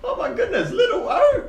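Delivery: put-on old uncle thrilled to see him; delighted old gnome. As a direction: squeal with joy at seeing a nephew. GM voices delighted Quince.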